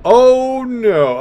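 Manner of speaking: sudden high, loud oh no; startled yelp of alarm; surprised. Real, not acted. Salvo hits the ship.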